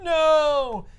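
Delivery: high, loud despairing cry; mock anguished no. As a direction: cry out in defeat. [name] despairing.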